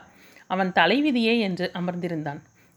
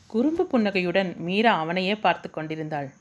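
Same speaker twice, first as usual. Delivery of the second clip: usual speaking voice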